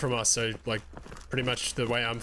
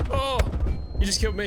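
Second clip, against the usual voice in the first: loud, high, surprised cry; shocked outburst; 9 semitones higher, 4 dB louder than usual. Real, not acted. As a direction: blurt it out in disbelief. Teammate shot them.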